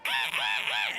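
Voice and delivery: imitated monkey chatter, loud and voiceless; monkey screech. Monkey answering the goblin, loud imitated screeches. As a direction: screech in short monkey bursts. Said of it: "the monkey kind of just go".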